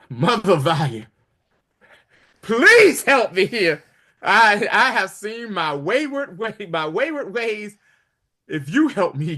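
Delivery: whining, high-pitched plea for help; pleading emperor. Retold or imitated. Emperor begging Valia for help.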